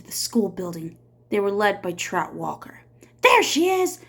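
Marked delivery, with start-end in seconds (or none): 3.2-4.0 loud, high angry shout. Acted. Trout shouting at the mob. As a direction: yell it out, pointing her out to the mob. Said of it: "Trout shouted".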